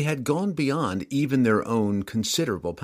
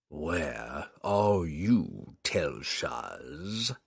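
low, slow, drawn-out demon hiss; demon's hiss; 6 semitones lower, 5 dB quieter, much slower than usual. Acted. Demon lord calls into the gloom for his servant, slow low hiss. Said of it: "the demon hissed".

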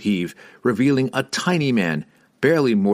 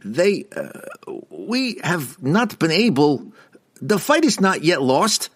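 stammering; 8 semitones higher, slower than usual. A narrator's reading. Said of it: "Rassiter stammered".

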